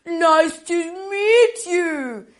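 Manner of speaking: lightly voiced grandma; Grandma's greeting. Shown as a demonstration. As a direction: greet the cats like a kind old grandma. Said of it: "you say".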